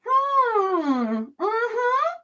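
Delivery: high tight forced hum; mock-polite gritted-teeth hum; through gritted teeth. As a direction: swallow the annoyance and hum. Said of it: "grit your teeth and just go".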